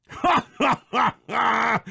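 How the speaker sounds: big, high villain laugh; hearty, scornful ha-ha-ha; mocking laugh. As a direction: laugh in the rival's face. Mocks a rival's poor chopping.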